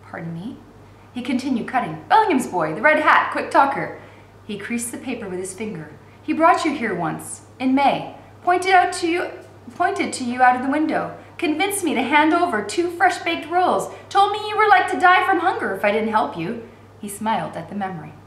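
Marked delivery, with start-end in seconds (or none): 0.1-0.6 hushed, startled pardon me; frightened whisper. Acted. Girl caught off guard by the bookseller, whispering. 2.1-16.5 put-on a bit higher, chatty young clerk; a lively young man recalling a memory; lightly voiced young bookseller. Bookseller telling the girl about the boy, lightly voiced.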